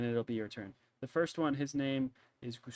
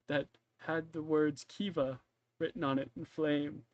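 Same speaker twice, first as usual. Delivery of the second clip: ordinary talk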